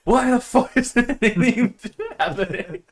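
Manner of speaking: high, loud outburst breaking into laughter; retold raging. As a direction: replay your rage, half laughing. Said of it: "I just start raging again".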